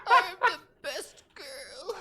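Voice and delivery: very high, loud, slow squeaky creature voice. Acted. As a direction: squeal it like a tiny proud creature. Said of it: "and she just goes".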